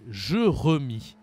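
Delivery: slow "je remis" with rounded eu in both first syllables; wrong-name demo, euh sounds in "je remis"; missing acute accents. Shown as a demonstration. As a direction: say "je remis" as juh-ruh-mee, stretched out. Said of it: "Si je n'utilise pas d'accent ici".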